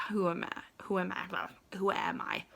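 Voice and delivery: whispered middle repeat; whispered self-question. Real, not acted. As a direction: drop to a whisper for this one. Repeats question three ways.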